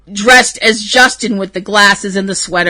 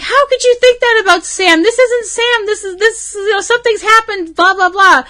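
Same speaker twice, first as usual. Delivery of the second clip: much higher, protesting pitch all through; indignant fan rant; 11 semitones higher than usual. Retold or imitated. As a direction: squeal out the outraged defence of Sam. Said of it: "but I was like".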